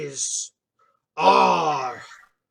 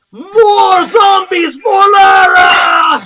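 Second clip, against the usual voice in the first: high, slow bellow; crowd yell; 12 semitones higher, 10 dB louder, much slower than usual. Acted. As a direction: yell it across the arena. Fan shouting from crowd.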